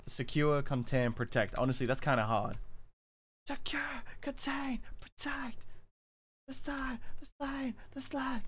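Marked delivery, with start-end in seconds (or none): 3.5-5.6 high, soft, eerie chant; creepy voice. Shown as a demonstration. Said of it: "That's creepy". 6.5-8.4 high, soft, eerie repeating; creepy voice. Shown as a demonstration. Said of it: "That's creepy".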